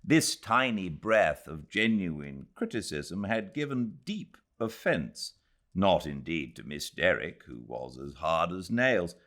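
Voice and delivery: ordinary delivery, nothing marked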